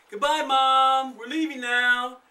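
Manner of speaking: loud, slow call to the house; hollering. Acted. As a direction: shout goodbye from the door.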